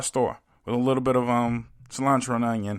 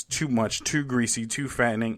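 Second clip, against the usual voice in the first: ordinary delivery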